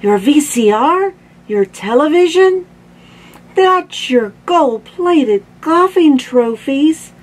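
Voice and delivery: high, loud rising questions; a worried mom running through the valuables; alarmed guessing. A narrator's reading. Mom anxiously guesses what was stolen.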